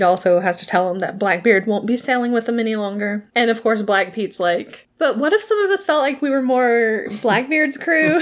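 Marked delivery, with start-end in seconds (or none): 5.0-8.2 whiny quote, laughing. Retold or imitated.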